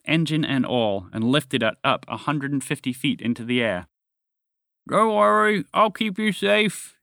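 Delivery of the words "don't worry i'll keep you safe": mumbled. A narrator's reading. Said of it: "he mumbled".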